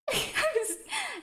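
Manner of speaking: short high-pitched giggle; embarrassed little giggle. Real, not acted. Laughs after music mishap.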